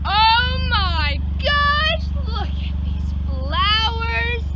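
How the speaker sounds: high, loud, drawn-out delight; excited gasp. Real, not acted. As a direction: gush over the flowers as if stunned. Discovering flowers in a yard.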